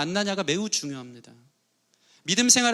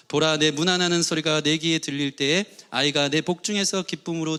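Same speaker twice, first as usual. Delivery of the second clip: scripture reading aloud; 3 semitones lower, 5 dB louder than usual. Real, not acted. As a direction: read it out together, deliberate. Congregation reads scripture aloud.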